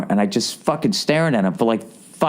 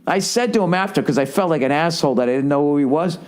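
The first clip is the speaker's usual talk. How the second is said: ordinary talk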